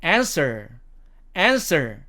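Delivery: American "answer", front a and sounded r; American demo of "answer", r kept; American accent. Shown as a demonstration. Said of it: "미국식 발음으로는".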